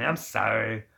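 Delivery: ordinary delivery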